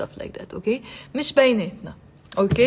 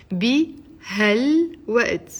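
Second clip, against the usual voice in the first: بهالوقت drawn out in separate pieces, "bi-hal-wa't"; slow syllable-by-syllable; slower than usual. Shown as a demonstration. Teacher repeats phrase broken into syllables.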